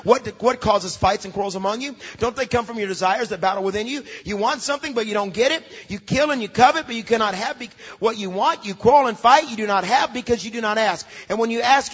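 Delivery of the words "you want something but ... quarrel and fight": normal talking voice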